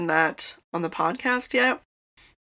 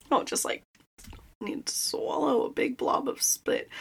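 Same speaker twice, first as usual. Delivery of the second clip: soft unvoiced aside, half to herself; whispered aside; 5 semitones higher, 7 dB quieter than usual. Real, not acted. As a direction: drop to a whisper for a private aside. Whispered aside about dry mouth.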